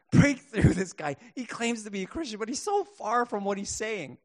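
loud pleading prayer, pushed up high; mock fervent prayer. Praying friends begging God for the husband, loud pleading. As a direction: cry out to God for him.

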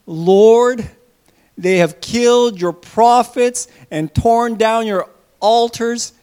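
loud, slow lament to God; despairing prayer. Acted. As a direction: cry out alone and defeated, slowly. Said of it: "he says".

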